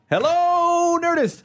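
mock fan voice. Reading a fan's letter aloud.

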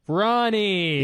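high, loud drawn-out cheer; elated, stretched-out shout; excited cheer. Real, not acted. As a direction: call out the name like a delighted fan. Cheering the player just announced.

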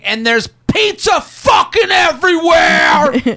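a hammed-up blowup at the roommate; a mock rant shouted at a messy roommate; mock furious shout. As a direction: yell it high, loud and slow. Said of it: "with you going".

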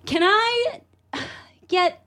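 imitating Colin. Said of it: "he'll go".

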